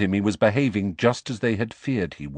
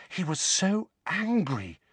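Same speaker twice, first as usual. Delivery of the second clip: hushed, slow and amazed; awestruck; 9 semitones higher than usual. Acted. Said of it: "in an almost awestruck voice".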